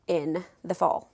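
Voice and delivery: ordinary talk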